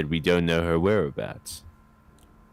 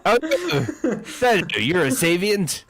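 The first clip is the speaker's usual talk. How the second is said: high, laughing mock alarm; laughing surprise; 11 semitones higher, slower than usual. Real, not acted. Player reacts to Saito's religion with high laughing mock alarm. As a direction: gasp it out through laughter.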